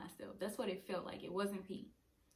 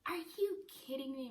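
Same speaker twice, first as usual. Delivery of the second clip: higher, mock-incredulous mom; lightly hammed-up disbelief; dramatic mom; 6 semitones higher than usual. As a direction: imitate an overdramatic mother. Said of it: "My mama is so dramatic".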